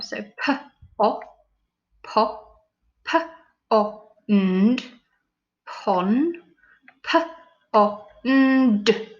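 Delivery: each sound said separately, stretched; sounding out letters. Shown as a demonstration. Teacher segmenting the word for pupils. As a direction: build it up sound by sound. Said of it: "we're going to say each sound".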